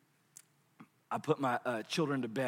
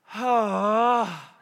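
mock groan; 6 semitones higher, 5 dB louder than usual. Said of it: "And he'll go".

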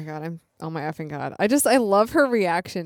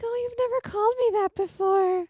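put-on surprised girlfriend. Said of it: "and you're like".

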